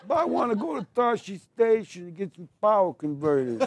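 put-on high, whiny farm boy complaining through a laugh; laughing as he mimics a sulky young Luke; whiny Luke Skywalker. Interviewer prompts him, so he does young Luke whining. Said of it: "start with Uncle Owen, these droids".

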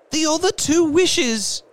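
very high, loud, eager; rapid reply. A narrator's reading. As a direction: burst out with it urgently. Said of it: "she replied rapidly".

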